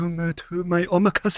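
put-on slow Japanese-accented chef; mock Japanese accent. Chef welcomes guest to his omakase, mock Japanese accent. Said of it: "Can you do the accent for me".

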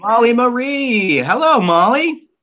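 high, slow sing-song dog talk; baby talk to dog. Acted. Host greeting Molly the dog in high baby talk. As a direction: coo at the puppy like baby talk.

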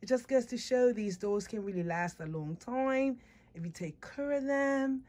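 ordinary talk

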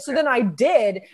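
ordinary talk

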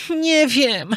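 high-pitched, wavering reply; hesitant puppet. A narrator's reading. Puppet unsure about leaving for Toyland, hesitant. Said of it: "zawahał się pajacyk".

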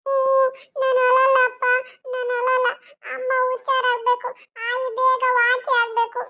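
put-on high child voice holding back tears; a small kid promising to be brave; brave little boy. Child promises not to cry. (ಅಳು ತಡೆಯುವ ಪುಟ್ಟ ಹುಡುಗನ ದನಿ)

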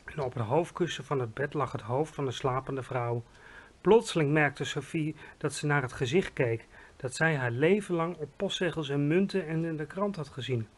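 plain reading voice

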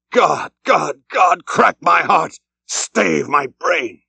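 slow, rising plea to God; anguished outcry. A narrator's reading. Ahab crying to God in anguish before Starbuck. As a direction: cry out in despair, slowing at the end.

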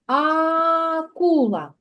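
акула stretched slowly, each sound drawn out; slow teacher demo of the sounds. Shown as a demonstration. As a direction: draw out акула so the А sounds are heard. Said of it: "промовляємо звук А".

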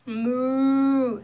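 lightly voiced cow mooing; a mild moo, like a cow. Reading to a child, a gentle cow moo. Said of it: "said the cow".

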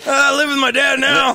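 mock put-on voice. Joking about living with a dead dad, mock put-on voice.

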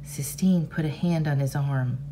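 ordinary delivery, nothing marked

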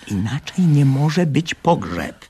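loud, slow, firm; insisting there must be a funeral; loud insistence. Acted. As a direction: insist over her shouting. Boy insists over her.

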